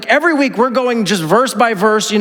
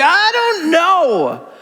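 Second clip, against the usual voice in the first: slow, high, drawn-out "I don't know"; helpless shrug; 9 semitones higher, much slower than usual. Retold or imitated. Churchgoer overwhelmed by conflicting news, slow helpless shrug. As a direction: let it hang in lost confusion. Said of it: "this feeling of, like".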